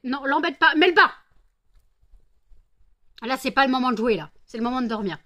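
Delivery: loud high shout at the cat; yelling at pet. Real, not acted. (crie sur le chat)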